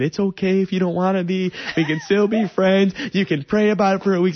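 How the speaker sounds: high, loud, hurried nervous backpedalling; nervous babbling. Retold or imitated. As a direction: rush out reassurances before getting an answer. Nervous babbling before she can answer.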